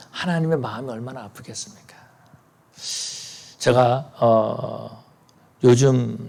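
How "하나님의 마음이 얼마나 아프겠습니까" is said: whispered. Real, not acted. Pastor drops to whisper on grief.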